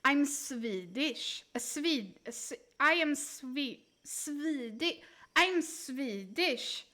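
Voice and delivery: slow Swedish English with drawn-out I; exaggerated Swedish accent. Shown as a demonstration. Streamer showing how Swedes sound, exaggerated Swedish accent. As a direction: stretch the I like a Swede. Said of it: "closest I can do from Swedes".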